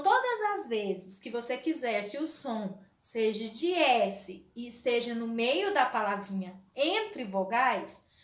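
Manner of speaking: ordinary talk, nothing marked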